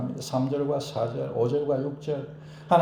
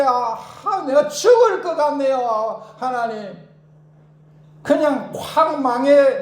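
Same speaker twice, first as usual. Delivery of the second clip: much higher and louder, raging lament; venting prayer; 9 semitones higher, 6 dB louder than usual. Shown as a demonstration. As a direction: spill out fury at an enemy. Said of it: "그래 기도도 됩니다". (분노를 쏟는 기도)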